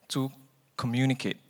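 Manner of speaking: ordinary delivery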